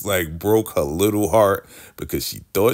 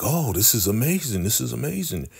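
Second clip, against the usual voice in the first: gushing delight; 7 semitones higher than usual. Retold or imitated. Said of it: "And he's like".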